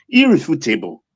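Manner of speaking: usual speaking voice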